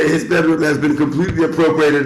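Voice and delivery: ordinary talk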